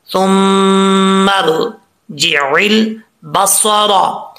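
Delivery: सुम्मा रजिउल Basra drawn out, the अलिफ dropped and the रा full; slow recitation. Shown as a demonstration. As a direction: recite the phrase slowly for students. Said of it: "रा पुर पढ़ा जाएगा". (सुम्मा रजिउल बसरा धीमी तिलावत में)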